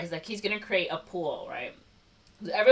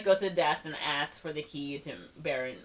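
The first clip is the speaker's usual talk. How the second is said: ordinary delivery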